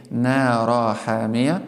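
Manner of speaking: nara hamiya with the final n left out; wrong, nun dropped from naran. Shown as a demonstration. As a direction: drop the n of naran before hamiya. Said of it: "It is wrong to say".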